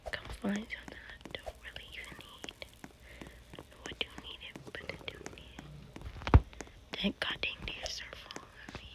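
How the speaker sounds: very soft whispered self-talk; whispering. Real, not acted. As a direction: whisper to yourself, voiceless and hushed. Muttering to self at night.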